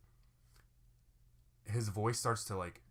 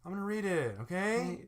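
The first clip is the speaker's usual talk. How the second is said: put-on high, annoying kid voice; petulant, pitched-up insistence; impatient whine; 9 semitones higher than usual. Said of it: "I'm so impatient".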